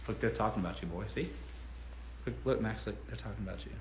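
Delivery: low, soft doting voice for the dog; soft talk to dog. Real, not acted. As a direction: murmur fondly to your dog. Talking sweetly to dog.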